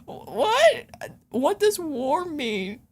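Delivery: high-pitched, drawn-out incredulous question; mock bafflement. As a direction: squeak the what, then stretch the question. Acts out her baffled reaction.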